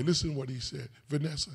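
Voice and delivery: ordinary delivery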